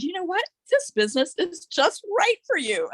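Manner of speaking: high, bright retold exclamation, laughing on the second line; husband cheering her on, voice jumps up with a laugh; husband's delighted remark. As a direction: quote her husband with a laugh, pitched well up.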